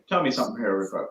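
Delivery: ordinary delivery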